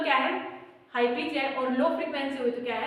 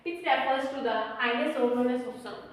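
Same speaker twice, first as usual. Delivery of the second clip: slow, deliberate dictation for notes; 3 semitones lower, 3 dB quieter, much slower than usual. Real, not acted. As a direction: read it out slowly so they can write. Dictating definition to students. (धीमे-धीमे लिखवाना)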